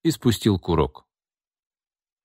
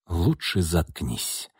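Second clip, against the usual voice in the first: slow, soft threat; menacing; 2 semitones lower, 5 dB quieter, much slower than usual. A narrator's reading. Man warns his partner in the corridor, menacing and slow. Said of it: "угрожающе сказал он".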